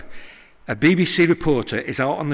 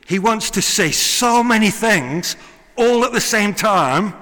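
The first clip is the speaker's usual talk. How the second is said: high, loud, excited preaching; soaring preacher building fervour; preaching at full force; 8 semitones higher, 6 dB louder than usual. Acted. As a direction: let the words tumble out with passion. Preacher excited about John's writing.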